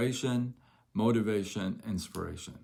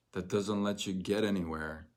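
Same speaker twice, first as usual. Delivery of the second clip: normal talking voice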